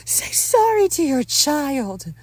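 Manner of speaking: high, slow, loud command; stern maternal order. Acted. Wife orders husband to apologize to son, high stern voice.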